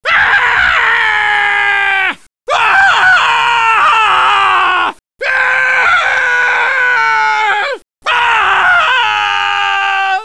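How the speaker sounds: long, high, full-volume scream; hammed-up drawn-out death scream. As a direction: yell out in one sustained cry.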